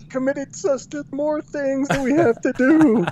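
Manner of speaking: high, slow comic wail; hammed-up despair, drawn out and high; mock staff lament. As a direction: moan it like overworked staff. Voicing the dismayed staff.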